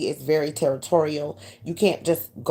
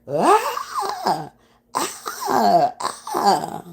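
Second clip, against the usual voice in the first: imitated cries; 7 semitones higher, much slower than usual. Hearing cries after the boom.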